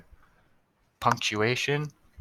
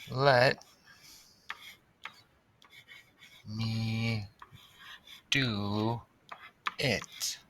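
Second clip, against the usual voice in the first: each word alone, long pauses, softer; slow dictation; 5 dB quieter, much slower than usual. Shown as a demonstration. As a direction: spell it out as she writes. Writing the sentence word by word.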